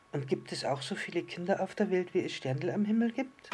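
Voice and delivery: curious little star. Acted. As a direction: ask it as a small, wondering star.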